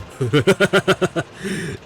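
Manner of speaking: hearty laugh. Acted. Railway worker amused by the ghost hunt, hearty laugh. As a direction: laugh heartily in a long run.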